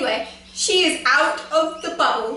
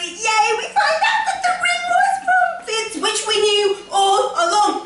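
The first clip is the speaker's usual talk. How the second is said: much higher, bursting with fan excitement; excited yay; 8 semitones higher than usual. Real, not acted. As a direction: shout it out like a thrilled fangirl. Fan excitement about the ring.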